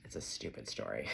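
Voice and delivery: weary boulder pusher giving up, low; lightly voiced Sisyphus. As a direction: grumble the refusal. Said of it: "being like".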